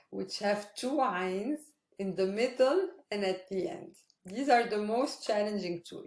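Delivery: ordinary talk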